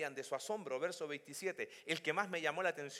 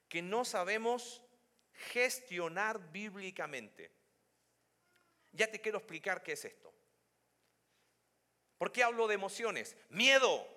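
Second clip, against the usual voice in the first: ordinary delivery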